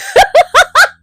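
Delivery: loud, high burst of laughter; big high cackling laugh. Real, not acted. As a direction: crack up at your own joke. Laughs at own logic.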